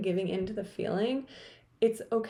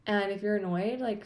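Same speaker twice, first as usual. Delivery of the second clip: normal talking voice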